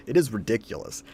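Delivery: normal talking voice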